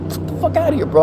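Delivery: high mock-outraged protest; acted-out indignant dismissal; indignant rejection. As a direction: brush him off in exaggerated outrage. Retold angry rejection.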